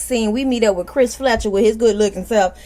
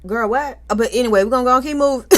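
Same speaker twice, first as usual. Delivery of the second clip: usual speaking voice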